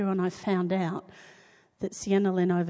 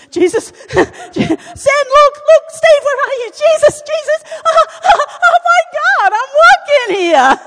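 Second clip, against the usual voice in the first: much higher, much louder, crying out with laughter; ecstatic shouting; 14 dB louder than usual. Retold or imitated. Healed woman calling to friends in overwhelmed shouting joy. Reliving the healing moment, shouting.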